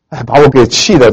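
ordinary talk, nothing marked

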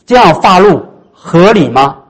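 slow, loud recitation; congregation recitation. Shown as a demonstration. Preacher leading the congregation to say it slowly and loudly. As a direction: lead everyone in saying it. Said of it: "一起来说".

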